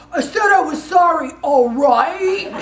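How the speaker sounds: loud, high mock-defensive husband; exaggerated exasperated husband impression. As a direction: bellow a grudging apology like a fed-up husband. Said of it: "we call it our husband voice".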